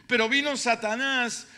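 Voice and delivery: normal talking voice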